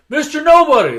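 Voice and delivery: high, loud call of the name; the Rock calling out to Kurt Russell's character. Retold or imitated. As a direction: shout the nickname at the camera. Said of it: "He's like".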